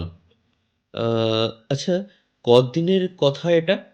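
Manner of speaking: plain reading voice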